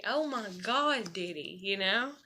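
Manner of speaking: ordinary talk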